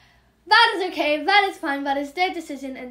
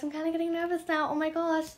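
fast, nervous, softer patter; nervous rushing; 2 semitones higher, 5 dB quieter, faster than usual. Real, not acted. Nervous while adding items to the trade, fast and softer. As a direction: rattle it off under your breath. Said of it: "One, I am talking so fast".

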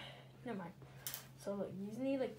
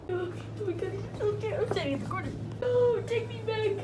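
high, loud mock terror; play-acted panic; 10 semitones higher, 8 dB louder than usual. Tyrone in the elevator begging out, pretend panic. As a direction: cry out as if trapped.

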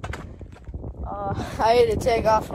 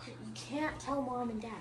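whispered plea; 13 dB quieter than usual. Real, not acted. Begging sister keep secret.

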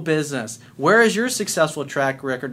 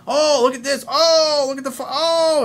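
high, put-on outraged gasping voice; mock alarm; 10 semitones higher, 3 dB louder than usual. Mocking news networks fanning the flames, alarmed. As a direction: imitate hysterical gawkers, pitched way up.

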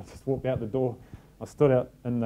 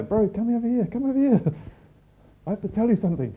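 high, louder call from down the corridor; urgent calling over; 10 semitones higher, 4 dB louder than usual. Retold or imitated. French skier calling the coach over, urgent and high. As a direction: wave a mate over with urgency. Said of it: "he's calling me over".

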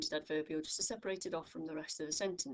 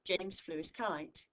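ordinary talk, nothing marked

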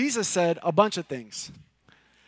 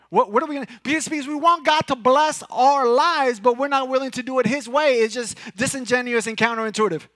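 rising, louder preacher's push; full-force preaching; 6 semitones higher, 7 dB louder than usual. Acted. Pastor rebuking the church for wanting blessings its own way, preaching hard. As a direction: drive it home to the congregation, raising the voice.

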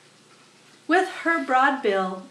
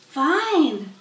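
Charlotte voice; 6 semitones higher, 5 dB louder than usual. Acted.

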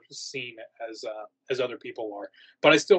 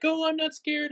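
high, sing-song taunt of a cocky guest; cocky guest scoffing that they aren't scared; mocking cocky guest. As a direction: mimic a smug guest way up high. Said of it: "people that are like".

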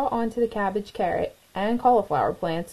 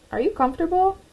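higher, calling across; high calling caterpillar; 9 semitones higher, faster than usual. A narrator's reading. Cornelius calls to Clara in her chrysalis, high and light. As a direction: call over to a friend's chrysalis.

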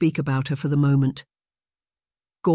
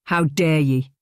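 a sharp, stung rebuke. Acted. Ife, called a coward, rebukes his son sharply. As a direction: snap it back, offended. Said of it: "Ife said sharply".

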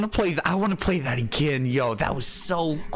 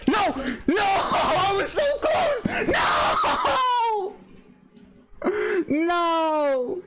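laughing despair shout; much slower than usual. Real, not acted. Player shouting and laughing after losing so close to winning. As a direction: scream the near-miss half laughing. Loses just before winning.

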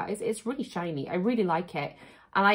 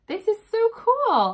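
high, slow delighted gush; excited; 12 semitones higher, 4 dB louder, slower than usual. Real, not acted. Feeling the sign's quality, high slow delighted gush. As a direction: let the pitch leap up with real delight.

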